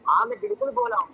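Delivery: loud, defiant yell; angry shouting. Real, not acted. Heated argument on phone.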